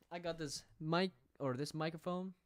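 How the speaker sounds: usual speaking voice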